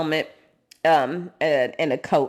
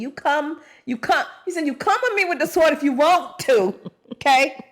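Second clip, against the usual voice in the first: higher, louder taunting challenge; defiant David; 7 semitones higher, 3 dB louder than usual. Retold or imitated. David taunts Goliath, a higher louder defiant dare. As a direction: dare him to come at you. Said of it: "David replied to the Philistine".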